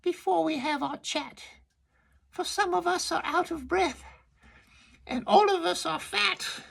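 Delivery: very high squeaky oyster voices; tiny shellfish squeaking a protest; little oysters. Acted. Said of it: "the oysters said".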